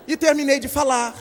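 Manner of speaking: usual speaking voice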